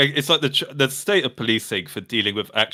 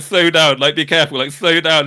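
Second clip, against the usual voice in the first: urgent plea; 4 semitones higher, 6 dB louder than usual. Retold or imitated. Captain pleading with driver.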